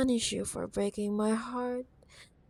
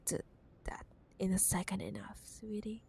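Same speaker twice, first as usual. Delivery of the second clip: whisper; 15 dB quieter, faster than usual. Acted. Teasing aside about the contract.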